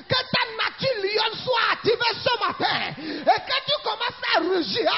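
loud, high, shouted sermon climax; impassioned pulpit shouting; full-force preaching. Real, not acted. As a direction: rouse the congregation at the top of your voice.

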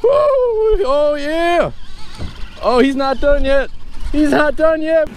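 high excited whoops; fighting a fish. Real, not acted. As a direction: cheer as the fish keeps running.

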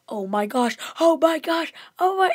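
loud mock panic climbing high. Co-host retells Monk at the sip step, rising mock panic. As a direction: build to a high, hammed-up cry.

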